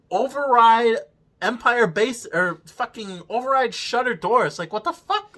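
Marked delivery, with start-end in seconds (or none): 4.6-5.4 incredulous. Real, not acted. Disbelief at plot.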